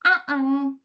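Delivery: short nasal uh-uh, rising; nasal fish crow call. Shown as a demonstration. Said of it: "their sound is more nasally".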